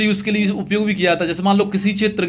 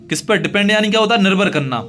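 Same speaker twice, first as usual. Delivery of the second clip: usual speaking voice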